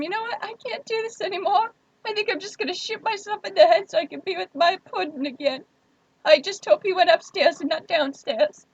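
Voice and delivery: high, hammed-up hopeless voice; mock despair. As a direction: act like you've given up on everything. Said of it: "all the emotional trauma in my voice".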